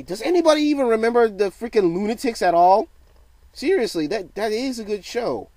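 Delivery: loud, high-pitched disbelief; excited. Real, not acted. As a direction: burst out, raising the pitch way up. Excited rant about old show.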